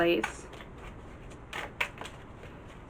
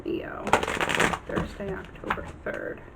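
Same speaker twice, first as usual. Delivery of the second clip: muttering to herself; 7 dB quieter than usual. Real, not acted. Muttering while writing the date.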